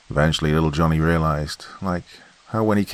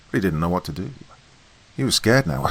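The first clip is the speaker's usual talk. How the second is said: ordinary delivery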